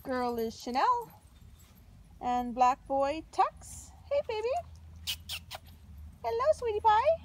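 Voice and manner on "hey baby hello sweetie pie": higher, sing-song pet talk. Real, not acted. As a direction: coo lovingly to a puppy. Cooing to the puppies.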